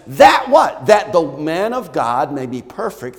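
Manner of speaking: high, loud call to the crowd; rousing preaching. Acted. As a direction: rouse the congregation.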